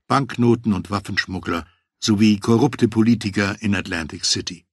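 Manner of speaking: ordinary delivery, nothing marked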